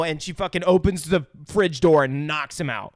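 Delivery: usual speaking voice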